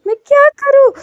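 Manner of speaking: high, loud panicked yelp; panicked squeal. Acted. Caught by his boss, panicking.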